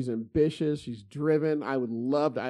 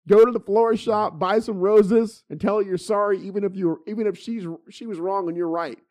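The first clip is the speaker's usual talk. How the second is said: fired-up advice; 5 semitones higher, 6 dB louder than usual. Retold or imitated. Retelling his heated advice to neighbor.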